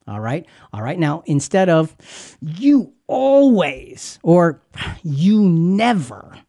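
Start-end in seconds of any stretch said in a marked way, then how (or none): 2.4-4.2 slow, much higher, drawn-out "You always"; hammed-up accusation, slow and high; mock accusing spouse. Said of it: "Easy, Rick". 5.0-6.4 mock accusation. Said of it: "Easy, Rick".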